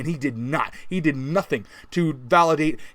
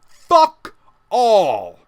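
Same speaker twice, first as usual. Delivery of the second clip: loud blunt snap; angry punchline; 3 semitones higher, 6 dB louder than usual. Real, not acted. Ranting fan telling viewers what Di Maria did, loud and blunt. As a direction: slam the two words down hard.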